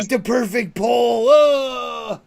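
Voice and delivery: high, loud hammed-up lament; mock despair. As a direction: wail like a tragic failure, jokingly. Mocking a friend's supposed despair.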